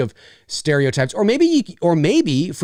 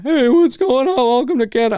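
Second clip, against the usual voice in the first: high, loud hammed-up hoser greeting; mock Canadian accent; 11 semitones higher, 6 dB louder than usual. Mocking how cartoons voice Canadians, hammed-up accent. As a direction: ham up the cartoon Canadian welcome.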